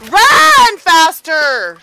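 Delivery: drawn-out loud holler; urgent shout. Real, not acted. Player urging fleeing settlers to run, urgent shout. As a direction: yell it long and loud to hurry them.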